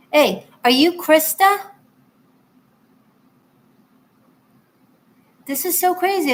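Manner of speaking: high, loud, slowed-down greeting of surprise; as if a friend just walked in unannounced; delighted surprise. Real, not acted. Reader spots the viewer's missed payment, excited surprise.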